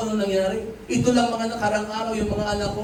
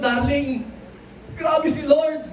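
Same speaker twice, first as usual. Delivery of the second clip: excited exclamation; 7 semitones higher, slower than usual. Retold or imitated. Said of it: "Sabi ko".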